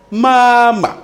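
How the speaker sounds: "mama" with a long, high, level first syllable. Shown as a demonstration. Teacher gives the Chinese word for mother, first-tone "mama". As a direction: hold the first syllable of "mama" high and loud, the second short. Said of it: "On dira aussi".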